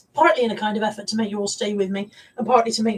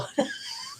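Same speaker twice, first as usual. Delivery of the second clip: high squeaky laugh; 10 dB quieter than usual. Real, not acted. Laugh after failed accent.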